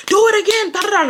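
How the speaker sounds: high, far-pitched hyper mock yell; imitating being on ten. Girl retelling her younger self on ten, hammed-up and high. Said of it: "I used to be, like, on ten".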